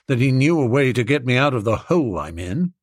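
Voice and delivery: plain reading voice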